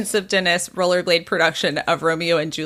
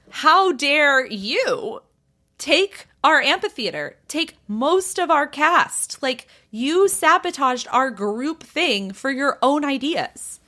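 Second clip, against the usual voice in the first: raised pitch, drawn-out mock outrage; indignant retort; 5 semitones higher, slower than usual. George confronts Dennis in the doorway, mock indignant. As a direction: throw the accusation back, slow and high. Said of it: "yelling at each other".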